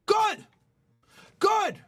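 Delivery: punchy high GOOD, falling hard; emphatic approval. Real, not acted. Coach applauds her noticing other girls, emphatic.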